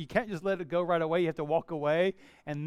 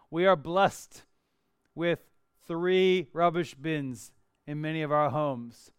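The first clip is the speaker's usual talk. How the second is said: usual speaking voice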